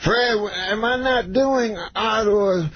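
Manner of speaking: high, drawling mimicked query; Bill Cosby. Star checks tour dates with agent, mimicked high and slow. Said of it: "He goes".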